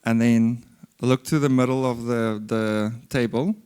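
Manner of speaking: talking with a pen in the mouth; pen in teeth. Shown as a demonstration. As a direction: say it while biting a pen. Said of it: "put the pen in your mouth".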